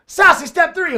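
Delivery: high, loud, slow sassy call; sassy announcer. Pretend. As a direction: strut the words out with sass. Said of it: "The sassy way".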